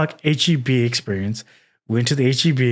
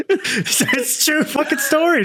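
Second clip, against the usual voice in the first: high, slow, giggling insistence; laughing; 12 semitones higher, slower than usual. Real, not acted. Laughing through the claim.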